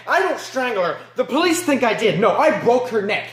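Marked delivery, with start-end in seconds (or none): none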